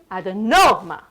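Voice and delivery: "Adenoma" with NO punched and held; stress on NO. Shown as a demonstration. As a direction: hit the NO of "Adenoma" hard. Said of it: "I wanna hear more of a no".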